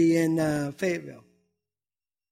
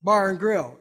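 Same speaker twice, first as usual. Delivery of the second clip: ordinary delivery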